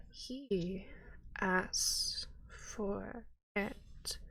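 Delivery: very slow hushed whisper. Acted. Priestess whispering about her god's demands. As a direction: drag it out in a breathy hush.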